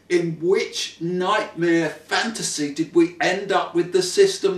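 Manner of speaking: indignant public-address gripe, high and loud; passionate rant. Pretend. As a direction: rant like it's a speech. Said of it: "delivered with a passion".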